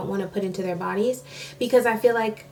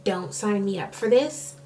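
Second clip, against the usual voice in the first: inner thought; 4 semitones lower, 8 dB quieter than usual. Retold or imitated. Inner thought while sitting there.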